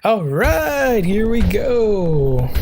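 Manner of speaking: slow, stretched-out here we go; drawn-out here we go. Real, not acted. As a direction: stretch every word out, teasing the moment. Drawn-out build-up before the event.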